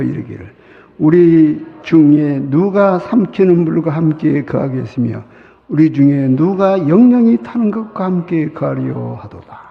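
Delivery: loud, measured recitation of scripture; a solemn public bible reading. Acted. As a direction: read the verse with solemn weight. Reads scripture aloud.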